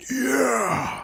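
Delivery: high, drawn-out yeah at normal volume; stretched-out, high-pitched celebration; excited yell. Retold or imitated. Acts out reaction to guitar sound.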